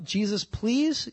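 slow, meek, politely pleading; meek soldiers. Retold or imitated. Soldiers answer Jesus after being knocked down, slow and meek. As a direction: shrink back and ask politely. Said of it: "I wonder if they changed their tone of voice".

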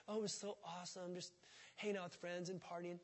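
soft, dreamy mock reminiscing; mock nostalgia. Preacher mocking party nostalgia, soft and dreamy. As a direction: murmur it like fond memories.

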